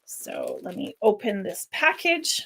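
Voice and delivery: usual speaking voice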